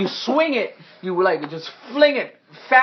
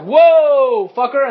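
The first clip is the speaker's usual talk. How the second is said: loud, higher acted whoa; startled exclamation; 7 semitones higher, 8 dB louder, much slower than usual. Game master voices a near miss by trident, startled. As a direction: react as if something just missed you. GM voices dodging player's reaction.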